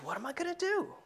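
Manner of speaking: a high, fretting mock cry; fussing aloud like a panicky rich man; worried rich man. Preacher voicing the rich man's worry, high and fretful.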